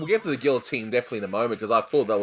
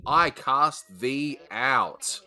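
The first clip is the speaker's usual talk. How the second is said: slow, drawn-out banishing line; mock exorcism; much slower than usual. Host quoting The Simpsons, mock-solemn banishment. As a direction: intone it grandly and slowly, half joking. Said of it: "Simpsons reference".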